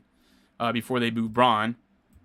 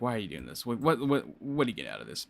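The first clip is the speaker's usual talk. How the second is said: exasperated; 7 dB quieter, faster than usual. Retold or imitated. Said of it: "and I'm just like".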